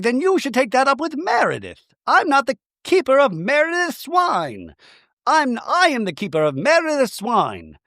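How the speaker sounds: high, hammed-up indignant delivery; indignant mock retort. Castmate disowns Meredith's wine, mock-indignant. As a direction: imitate a castmate protesting in high-pitched indignation. Acted reality-show quarrel.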